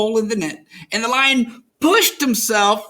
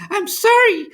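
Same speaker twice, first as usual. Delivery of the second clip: high, trembling mouse voice; tiny terrified mouse pleading; 5 dB louder than usual. A narrator's reading. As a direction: squeak it out, frightened.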